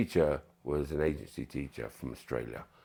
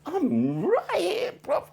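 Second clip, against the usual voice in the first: high mock voice; 7 dB louder, slower than usual. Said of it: "I'm like".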